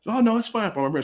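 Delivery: high-pitched dismissive mom voice; airy imitation of a reassuring mom; mock motherly reassurance. Mother brushing off his protest.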